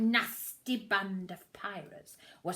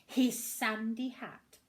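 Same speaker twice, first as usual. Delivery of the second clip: usual speaking voice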